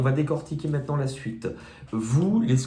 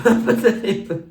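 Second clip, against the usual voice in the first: laughing; 9 semitones higher, 6 dB louder than usual. Real, not acted. Cracking up mid-sentence.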